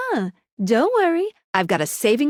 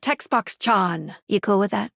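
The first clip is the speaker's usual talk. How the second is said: ordinary delivery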